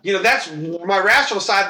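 ordinary talk, nothing marked

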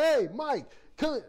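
mock shout to a colleague; calling a coworker over. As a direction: summon Mike gleefully. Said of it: "he's like".

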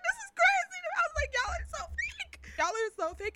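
high, incredulous squeak; retold disbelief. Onlooker reacting to fake fans, retold disbelief. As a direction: complain high in comic disbelief.

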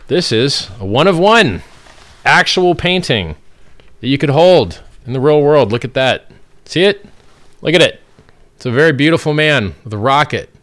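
loud, high, gushing art showcase; proudly presenting a real painting; excited showing off. Acted.

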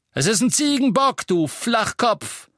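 high, loud exasperated insult; bellowing. A narrator's reading. Innkeeper correcting the Death Eater, bellowing. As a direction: roar it, slowing on the insult. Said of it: "brüllte der Wirt".

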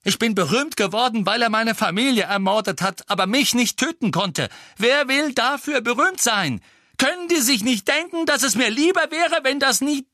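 high, loud, indignant shouting; a boy shouting his hurt, high and loud; angry outburst. Acted. Harry's angry outburst about fame.

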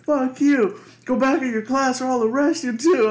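high, loud retold threat; angry mock yell. Officer threatening to arrest a student, angry mock yell. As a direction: yell it as a comic telling-off.

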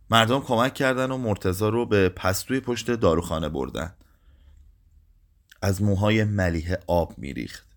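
plain reading voice

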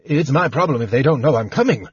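ordinary delivery, nothing marked

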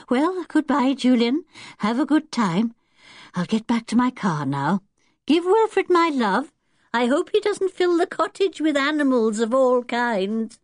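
high, lively farewell; friendly old lady. Acted. Old lady says goodbye to Julian, friendly and lively.

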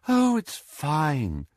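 put-on slow, high, dreamy girl. A narrator's reading. Girl dismissing her gnome bite, dreamy. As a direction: drift through it dreamily, drawn out. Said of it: "in a dreamy fashion".

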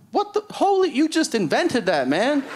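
very high-pitched, blown-away amazement; hammed-up awe, voice way up high; mock astonishment. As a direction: play an engineer totally floored by genius. Acts out awestruck software engineer.

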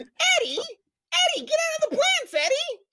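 very high cartoon squawk; hammed-up cartoon voice, way up high; Roger Rabbit. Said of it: "You sound like Roger Rabbit".

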